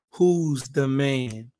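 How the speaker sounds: each word of "Who's the Man" spaced out slow, stress on "who's"; slow title announcement. Shown as a demonstration. Podcast host announcing his series title slowly. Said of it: "we are doing a series entitled".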